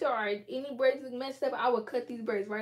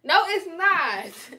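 very slow, louder; dragged-out denial; 6 semitones higher, 10 dB louder, much slower than usual. Real, not acted. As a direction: stretch it out stubbornly. Denying it's a bang.

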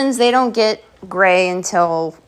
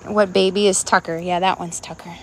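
ordinary talk, nothing marked

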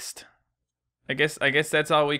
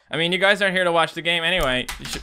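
normal talking voice

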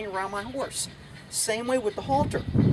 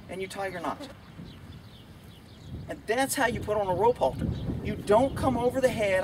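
ordinary talk, nothing marked

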